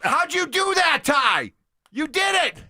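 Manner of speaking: high, loud delighted praise; thrilled cheering on; excited. Real, not acted.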